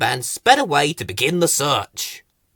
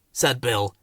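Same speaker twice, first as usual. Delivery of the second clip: usual speaking voice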